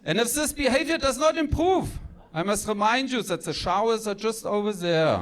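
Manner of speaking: mock threat from a strict German mother; stern German mom. Mom warns her son about his behavior, stern. Mom voice continues scolding son's report card.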